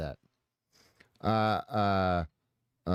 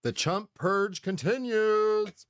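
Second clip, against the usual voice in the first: gloating; 11 semitones higher, much slower than usual. Real, not acted. Gloating slow announcement.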